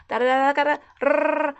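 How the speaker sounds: rapid-fire chatter. Retold or imitated. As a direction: mimic nonstop questions. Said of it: "like a rapid fire just going off".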